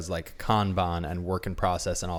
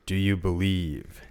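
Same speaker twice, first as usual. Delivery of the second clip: booming voice; slower than usual. Acted. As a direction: boom it from the heavens. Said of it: "And a booming voice says".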